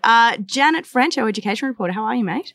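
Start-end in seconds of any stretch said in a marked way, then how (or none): 1.9-2.5 a light mock Aussie "How are you, mate?"; mock Australian accent. Host greets education reporter in mock Aussie accent. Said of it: "What accents are we doing now?".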